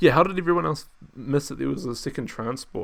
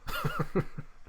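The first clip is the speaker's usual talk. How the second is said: very high giggle; real laughter; 6 dB quieter than usual. Real, not acted. Streamer laughing at the film, high giggle. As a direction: let out a high burst of laughter.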